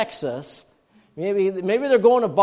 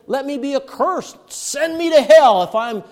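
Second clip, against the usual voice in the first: higher, loud angry oath; angry curse; 9 semitones higher, 8 dB louder than usual. Retold or imitated. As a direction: fling the curse out angrily. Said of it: "curses and swears in a big way".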